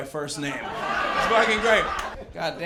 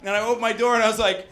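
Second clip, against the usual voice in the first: ordinary delivery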